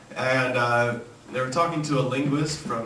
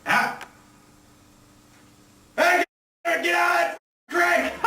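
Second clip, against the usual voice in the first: put-on high-pitched holler at the kids; sharp, high Southern shout; Southern parent yelling. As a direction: break off and yell to children far away. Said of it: "telling his children to quit playing in the creek".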